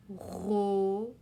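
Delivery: "Robot" drawn out slowly, a pause between syllables; "Robot" stretched syllable by syllable for learners; split into syllables. Shown as a demonstration. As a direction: sound out "Robot" as ro, then bo. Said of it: "As-tu deviné quelle est la syllabe modèle".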